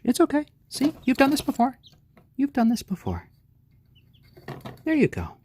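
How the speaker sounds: high sing-song pet voice. Real, not acted. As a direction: coo up high to reassure a little chick. Soothing chick during weigh-in.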